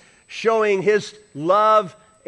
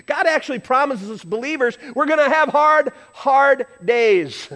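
emphatic preaching; 8 semitones higher, 5 dB louder than usual. Real, not acted. Preacher stressing hard days.

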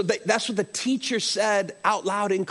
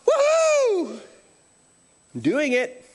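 joyful whoop; 10 semitones higher, slower than usual. Retold or imitated. Apostles leaving the Sanhedrin, whooping for joy. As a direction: cheer like a flogged apostle rejoicing. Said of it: "they went out going".

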